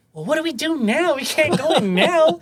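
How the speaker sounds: high, loud mock panic; laughing, what now; flustered panic. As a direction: caught out and laughing. Panicked quote after door slams.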